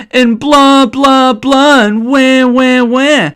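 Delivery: mock her complaints like a whiny child; mocking blah blah. Gamer mocking Johanka's complaints, high sing-song taunting.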